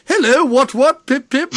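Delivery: high, loud hammed-up posh English accent; posh Southern English accent. Mocking Sam Elliott as a posh Southern Englishman, a loud high posh accent. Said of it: "posh and Southern".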